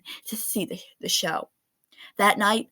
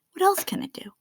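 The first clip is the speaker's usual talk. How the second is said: hushed inner thought; 10 semitones higher, 3 dB quieter than usual. Acted.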